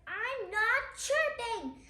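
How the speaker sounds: lightly voiced grumpy troll denying it; Branch's protest. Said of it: "Branch says".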